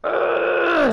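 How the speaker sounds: long, loud, very high "ugh"; frustrated screech. Real, not acted. Build has gone wrong, frustrated high screech.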